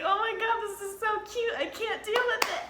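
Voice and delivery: high-pitched retold fangirl gush; mock overwhelmed gushing. Fan retelling being smiled at by a performer, high overwhelmed gushing. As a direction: gush as if it's too cute to bear.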